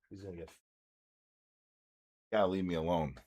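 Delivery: softly fed-up aside; quiet scolding. Real, not acted. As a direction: mutter to the pet away from the mic. Scolding the dog off-mic.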